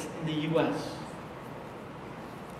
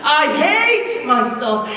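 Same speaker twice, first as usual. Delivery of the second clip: slow, raised-pitch retold self-loathing cry; anguished repeated cry; 5 semitones higher, 3 dB louder, slower than usual. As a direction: stretch HATE out, high and insistent. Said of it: "she kept saying this over and over".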